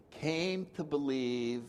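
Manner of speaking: came to believe drawn out slowly, each word weighed; slow it right down, leaning on came; slow, deliberate. Shown as a demonstration. Rephrasing the step for the group, slow and deliberate. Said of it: "let me rephrase it for you".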